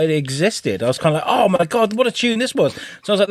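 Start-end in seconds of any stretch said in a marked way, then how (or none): 1.2-2.7 retold delighted exclamation, pitched high; delighted surprise. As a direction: exclaim over a rediscovered favourite. Retelling delighted rediscovery of song.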